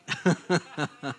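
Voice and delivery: a short burst of real laughter. Real, not acted. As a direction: laugh out loud. Laughs at his own comment.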